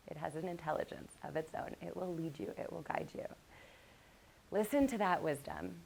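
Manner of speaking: soft, calm meditation voice; guided meditation. Acted. Wife guiding stressed husband through breathing, soft guided meditation. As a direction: ease them gently into calm.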